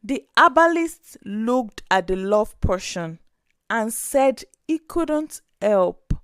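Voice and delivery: usual speaking voice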